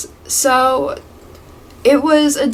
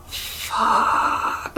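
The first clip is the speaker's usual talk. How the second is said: long, breathy whispered swear; awed whisper; 5 dB quieter than usual. Retold or imitated. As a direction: whisper it slowly, as if awestruck. Awed reaction to attractive coworker.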